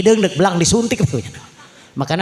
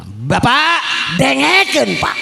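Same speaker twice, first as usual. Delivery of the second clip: commanding shout; 8 semitones higher, slower than usual. Acted. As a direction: order your father like a drill sergeant. Soldier son orders father loudly.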